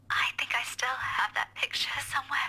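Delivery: whispered. Acted. Whispered teasing.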